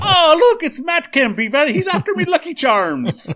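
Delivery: shrieky high, loud cartoon Irish brogue; Lucky Charms guy. Joking about a player chasing his cereal, hammed-up Irish accent. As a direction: cry out like an alarmed leprechaun, Irish accent. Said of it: "if I just do the Lucky Charms guy".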